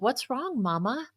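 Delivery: put-on high, soft, concerned child asking; little girl's voice. Four-year-old daughter asks her crying mother, high and soft.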